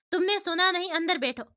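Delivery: angry shout. Acted. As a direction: shout her back into the car. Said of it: "वो काजल से चिल्लाकर कहता है।".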